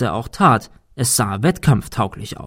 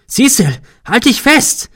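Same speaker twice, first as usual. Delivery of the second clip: much higher, loud panicked cry; desperate shout; 12 semitones higher, 6 dB louder than usual. A narrator's reading. Thomas screaming to falling Cecil, panicked. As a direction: scream it as he falls.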